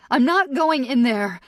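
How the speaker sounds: high, loud panicked protest; holler. Acted. Captive refusing to enter murder house, holler. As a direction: refuse at a shout. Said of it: "I holler".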